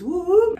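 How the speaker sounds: imitated police siren, pitched high; high "whoop whoop" of a police car. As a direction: mimic the siren as the lights come on. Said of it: "he hit his lights".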